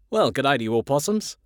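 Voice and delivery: put-on nervous burst of Australian accent. A narrator's reading. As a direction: greet them in broad Australian. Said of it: "talking in an Australian accent".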